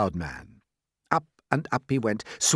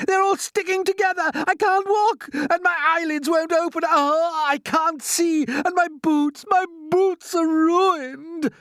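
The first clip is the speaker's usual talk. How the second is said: high, loud, anguished screeching; angry scream; 5 dB louder, slower than usual. A narrator's reading. Centipede finding himself glued up with paint, angry scream. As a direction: screech each complaint in outrage. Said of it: "The centipede screamed with anger".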